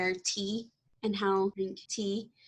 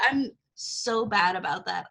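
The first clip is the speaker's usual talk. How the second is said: ordinary talk, nothing marked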